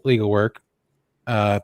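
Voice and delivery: usual speaking voice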